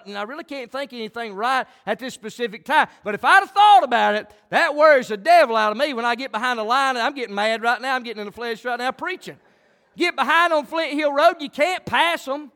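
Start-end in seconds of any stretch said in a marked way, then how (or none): 3.0-12.5 louder, higher preacher's fervour; fiery pulpit preaching; heated preaching. Acted. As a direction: work up the crowd. Preaching louder about getting mad.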